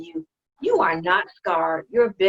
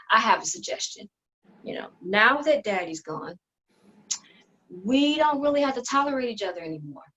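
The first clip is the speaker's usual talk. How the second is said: ordinary talk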